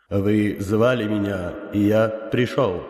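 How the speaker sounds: slow, powerful unearthly boom; inhuman booming voice. Acted. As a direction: speak like an otherworldly elder. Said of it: "мощным, нечеловеческим голосом произнёс".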